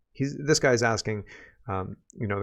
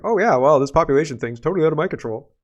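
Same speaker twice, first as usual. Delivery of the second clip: imitating feigned surprise, louder and higher at the start; mock pretending; 3 semitones higher, 5 dB louder than usual. Host mimicking the prime minister dodging blame, mock pretending. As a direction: play mock-clueless, starting with a loud put-on realization. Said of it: "he's pretending like".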